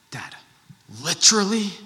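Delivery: put-on high, rising, incredulous child voice; imitating young daughter. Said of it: "She'll be like".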